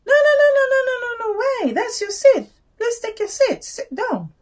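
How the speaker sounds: high-pitched mimicry of a woman refusing; imitated insistent woman. Elderly woman refusing a young man's seat, high insistent mimicry.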